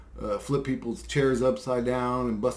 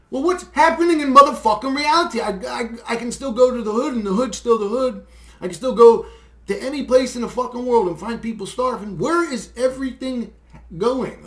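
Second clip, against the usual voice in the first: higher, louder furious rant; real outraged shout; 8 semitones higher, 9 dB louder than usual. Real, not acted. Venting to viewers about hunger and waste, shouting in outrage. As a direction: yell it out in disbelief at the world. Ranting at the state of the world.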